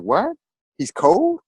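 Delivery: high, lightly voiced small child asking innocently; a small boy puzzled about grandpa. Nephew voice, innocent question.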